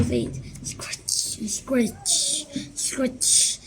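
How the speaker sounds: slow hushed scratching whisper. A narrator's reading. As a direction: hush it like faint scratching. Narrator whispering scratching sound.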